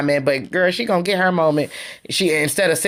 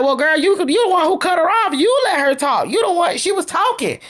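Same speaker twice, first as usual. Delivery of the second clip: imitated heated retort, high-pitched; argumentative retort; 10 semitones higher than usual. Retelling Stormy arguing over who cut her off, heated retort. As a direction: throw the blame back at her, voice jumping higher. Retold quarrel over interrupting.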